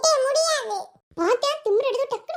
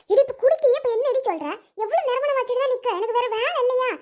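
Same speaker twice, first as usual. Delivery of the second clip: usual speaking voice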